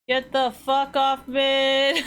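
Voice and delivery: loud, high, drawn-out shout; exasperated yell. Real, not acted. Gamer to others crowding the mid lane, exasperated yell. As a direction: yell it out slowly at full volume. Yelling at other players in game.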